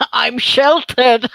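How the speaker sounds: slow, laughed-out words breaking into giggles; laughing. Real, not acted. Streamer admits to being sheltered, laughing. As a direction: say it through a rising laugh. Laughs while excusing own ignorance.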